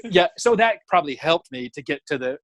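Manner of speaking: normal talking voice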